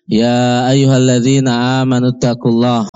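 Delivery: usual speaking voice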